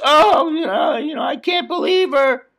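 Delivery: high, shouted whine as Christopher; Christopher whining. Retold or imitated. Christopher complaining to Tony, high whiny voice. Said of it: "Christopher says".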